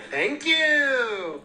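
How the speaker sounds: high, loud, triumphant "thank you"; triumphant shout. Real, not acted. Ending a silly confession to the group with a triumphant shout. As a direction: belt it out like a victory cry. Finishing story to the crowd.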